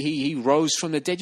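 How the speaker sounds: ordinary talk, nothing marked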